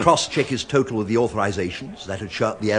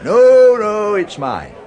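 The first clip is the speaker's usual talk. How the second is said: loud, higher jovial insistence; won't let him pay, bluster it; tipsy mates fighting over the round; insisting on paying; 7 semitones higher, 8 dB louder, slower than usual. Acted. Friendly argument over paying.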